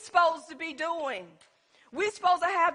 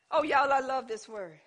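ordinary talk

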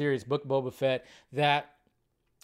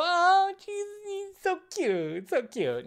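cooing over cuteness; much slower than usual. Retold or imitated. Host imitating fans melting over Grogu, high cooing. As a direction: coo like you're melting over a baby.

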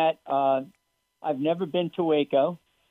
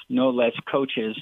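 ordinary talk, nothing marked

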